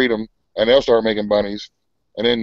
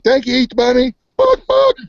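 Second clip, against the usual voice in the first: put-on high squeaky child, then a hen cluck; kid commercial voice; 13 semitones higher, 5 dB louder than usual. Recalling an Easter candy commercial, squeaky kid voice. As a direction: chirp it like a kid in a bunny ad. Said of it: "Remember that commercial?".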